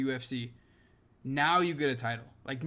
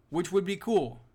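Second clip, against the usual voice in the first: ordinary talk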